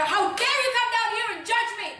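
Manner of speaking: indignant. Acted. Doctor confronts accuser.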